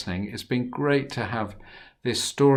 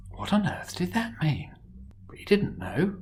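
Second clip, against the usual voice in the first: whisper; 7 semitones higher than usual. A narrator's reading. Narrator whispers Pharaoh's puzzlement.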